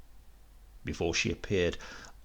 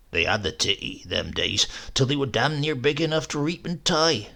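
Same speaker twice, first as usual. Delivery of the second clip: lightly voiced old countryman, a touch husky; old Uncle Silas; 2 semitones higher than usual. Great-uncle boasting of his boyhood to the boy, lightly voiced old countryman. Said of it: "husky, devilish voice".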